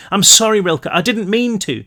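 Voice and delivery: desperate apology. A narrator's reading. Brick pleading.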